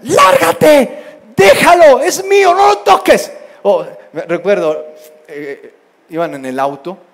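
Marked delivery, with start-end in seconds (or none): 0.0-3.3 high, full-volume shouted commands; sibling yelling. Retold or imitated. Child shouting at a sibling to back off, yelling. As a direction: yell like a kid guarding toys. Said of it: "Así se gritan".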